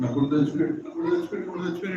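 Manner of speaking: mock crying father. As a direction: let the mock sob fade away. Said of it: "ఏడుస్తున్నాడు".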